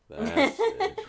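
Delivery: helpless giggly outburst; laughing. Real, not acted. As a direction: say it through a real laugh. Cracking up at the film.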